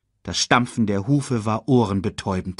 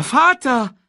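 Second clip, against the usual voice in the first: put-on high, loud child's scream; panicked call; 6 dB louder than usual. A narrator's reading. Simba watches Mufasa fall, crying out. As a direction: call desperately after father. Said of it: "rief Simba".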